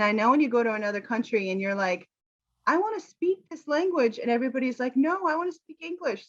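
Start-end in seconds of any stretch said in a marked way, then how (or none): 2.7-4.2 high, eager mock learner voice. 5.0-6.3 high, mock-insistent no; insistent local.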